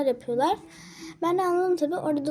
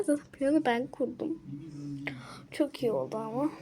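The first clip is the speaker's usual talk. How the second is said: whisper; 9 dB quieter than usual. Real, not acted. Secret aside about her plan.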